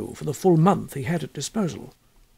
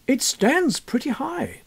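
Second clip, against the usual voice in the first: put-on young scholar; 9 semitones higher, slower than usual. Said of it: "said Mister Thompson".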